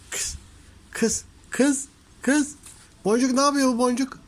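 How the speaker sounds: high, loud teasing scold; playful scolding. Real, not acted. Owner teases her cat Boncuk, high and loud.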